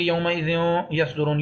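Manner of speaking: یومئذ ending in a nasal hum, no clear n; hidden noon. Shown as a demonstration. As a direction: hide the n of یومئذ before یصدرون. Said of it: "نہ ہی نون چھپائیں گے". (یومئذ کا نون ناک میں چھپا کر پڑھیں)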